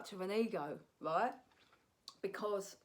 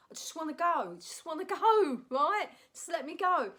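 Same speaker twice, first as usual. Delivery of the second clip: exasperated pleading; 6 semitones higher, 6 dB louder than usual. Retold or imitated. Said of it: "I was like".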